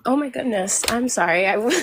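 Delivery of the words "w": ordinary delivery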